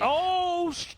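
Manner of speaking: sudden high yelp of surprise; "Oh" shot up high and loud; surprised shout. Real, not acted. Reacting to a shot live.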